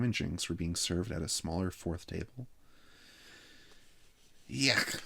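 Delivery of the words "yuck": put-on high child's cry of disgust; disgusted exclamation. A narrator's reading. Jeffrey chewing an egg sandwich, high disgusted exclamation. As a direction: blurt it like a boy who hates the taste. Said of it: "exclaimed Jeffrey Miller".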